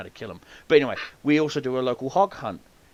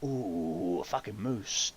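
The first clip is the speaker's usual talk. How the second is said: hushed awe; 5 semitones lower, 9 dB quieter, much slower than usual. Retold or imitated. Retold awed thought at moose.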